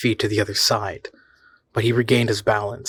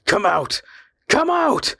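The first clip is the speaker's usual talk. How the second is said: scream, climbing very high; slower than usual. A narrator's reading. The man screaming at the hidden watchers, climbing higher. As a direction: scream it at the woods, rising to a shriek. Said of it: "he screamed".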